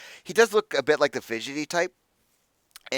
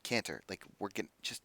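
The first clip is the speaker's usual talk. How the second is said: soft, low, faltering; small voice; 5 semitones lower, 14 dB quieter than usual. Acted. Masked figure appealing to the hothead, small and tentative. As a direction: start quietly and trail away. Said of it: "you hear a small voice".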